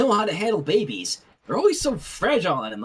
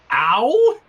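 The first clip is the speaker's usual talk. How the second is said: loud sharp cry of pain; pained yelp; 4 semitones higher, 8 dB louder than usual. Acted. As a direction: yelp as if a hand got slammed. Slammed hand in drawer.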